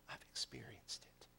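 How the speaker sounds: very soft, low whisper; whispered testimony. Acted. Believer answering doubters, soft whisper. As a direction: say it quietly and sure.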